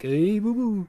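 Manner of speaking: lightly voiced bear greeting. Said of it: "a pitched up Yogi Bear".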